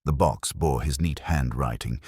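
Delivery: plain reading voice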